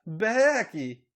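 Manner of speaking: high loud cries of pleasure; yelling. Acted. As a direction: cry out at the top. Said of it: "Charlotte yelled".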